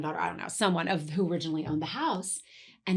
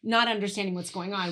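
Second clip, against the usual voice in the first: normal talking voice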